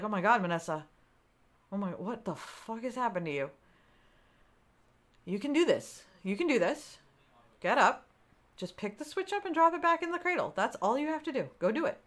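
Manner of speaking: lightly voiced coaching self; self pep talk. To herself at the broken Switch, mild pep talk. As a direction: urge yourself up, gently. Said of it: "I coached myself through".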